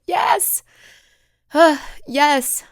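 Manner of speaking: emphatic yes. Retold or imitated. Her burst reply to God.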